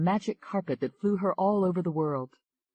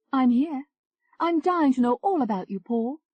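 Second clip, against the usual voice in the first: higher, lively English woman; higher bubbly chat voice; Tony, flirty; 10 semitones higher, 5 dB louder than usual.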